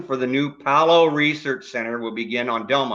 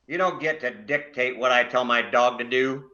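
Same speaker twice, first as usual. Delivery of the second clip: normal talking voice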